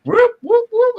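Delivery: high cheering whoops; excited whooping. Real, not acted. Cheering at the stream.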